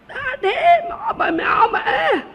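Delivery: put-on high, slow child calling Mama; child's call. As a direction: call out like a little kid. Imitates small children calling.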